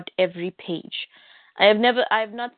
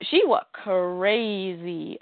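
drawn out, deliberate; slow emphasis; much slower than usual. Real, not acted. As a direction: spell it out slowly.

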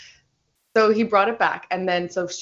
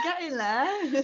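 English accent impression; 6 semitones higher, much slower than usual. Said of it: "that sounded like a little bit like".

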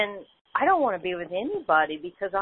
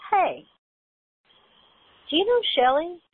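neighbor lady; 10 semitones higher, 4 dB louder than usual. Retold or imitated. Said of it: "and she goes".

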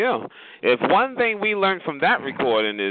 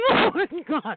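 high laughing oh my God; 11 semitones higher than usual. Real, not acted. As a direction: laugh through it. Laughing at the recording.

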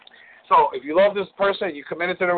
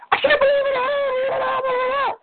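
mocking a wife's shrill squeal, much higher; high-pitched emotional woman. Imitating a wife's emotional outburst to her husband, in a mock squeal. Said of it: "that high-pitched emotional".

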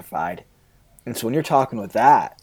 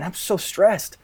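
higher, anxious self-talk; complaining to oneself; 7 semitones higher, slower than usual. Shown as a demonstration. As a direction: grumble it to yourself, worried. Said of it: "instead of just saying".

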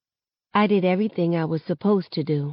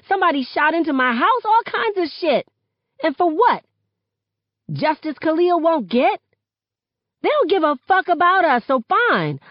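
high, loud, impassioned outburst; angry rant; 10 semitones higher, 4 dB louder than usual. A narrator's reading. Girl venting to friends about failed justice, high furious rant. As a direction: let the fury pour out, voice raised high.

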